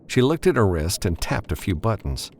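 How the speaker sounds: usual speaking voice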